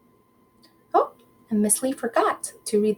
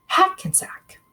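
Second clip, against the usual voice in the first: whispered name; 11 semitones higher than usual. Shown as a demonstration.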